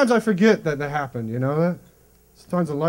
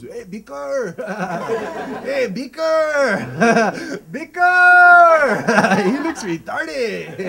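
put-on jeering kid calling out a nickname, building to a high laughing yell; mocking schoolkids; 6 semitones higher, 7 dB louder, much slower than usual. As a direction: taunt him across the hallway, cracking up. Kids mocking him in school.

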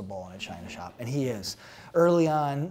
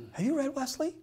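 teasing mock question; 14 semitones higher, 5 dB louder than usual.

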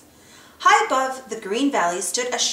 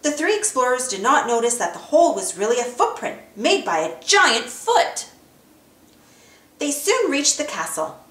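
ordinary delivery

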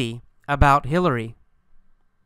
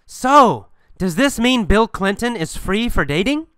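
raised, higher-pitched calling voice; yelling; 6 semitones higher than usual. Acted. Ex-girlfriend yells a question as she walks away, calling out. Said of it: "she yells to Sarin".

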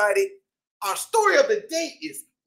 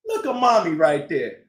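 ordinary delivery, nothing marked

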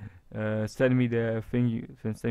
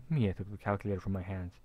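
ordinary delivery

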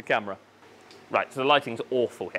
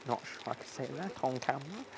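very soft, under the breath; barely voiced private remark; hushed aside; 12 dB quieter, faster than usual. Real, not acted. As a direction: drop right down as if off the record. Aside after awkward Latin name.

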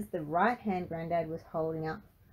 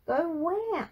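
high, loud demand; alarmed question; 11 semitones higher, 5 dB louder than usual. A narrator's reading. Frankie asks where granddad must go, alarmed. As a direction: ask sharply, pitch leaping up.

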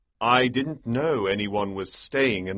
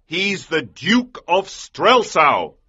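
louder, slow, stern man's voice; angry shout; 9 semitones higher, 4 dB louder, slower than usual. A narrator's reading. Johann angrily shouts at the girl. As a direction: scold the girl hard. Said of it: "he shouted angrily".

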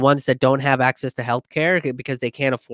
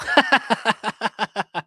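higher-pitched chuckle; real laugh; 8 semitones higher than usual. Real, not acted. Host reading a viewer's pun on Democracy Now, laughing. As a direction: burst of amused laughter.

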